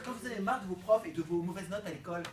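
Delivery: ordinary delivery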